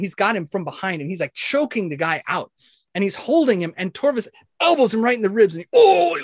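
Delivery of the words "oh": high, loud "oh" of pain; sudden high yelp at a blow; pained grunt. Acted. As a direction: cry out from an elbow in the ribs. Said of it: "like that".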